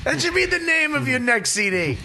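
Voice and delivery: high-pitched, gleeful outburst; real amused excitement, high voice; delighted teasing. Real, not acted. Excited teasing outburst at friend's story.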